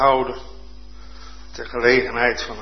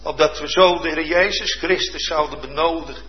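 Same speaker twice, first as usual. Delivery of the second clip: ordinary talk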